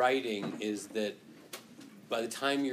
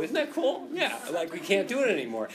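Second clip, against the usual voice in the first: excited; 8 semitones higher, 6 dB louder than usual. Real, not acted. Excited about vitamin C claim.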